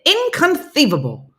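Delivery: high, loud, lisping exclamation; movie catchphrase impression. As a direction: squawk it like the film's quirky catchphrase. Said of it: "that's how I have to say it".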